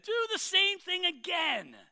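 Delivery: high, slow command; commanding Elijah. Acted. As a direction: order it with prophetic weight.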